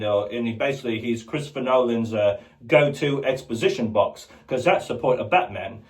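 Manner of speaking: ordinary talk, nothing marked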